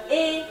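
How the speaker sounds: Et with the e held long and loud; Eeto, stretch the e over two beats; long vowel. Shown as a demonstration.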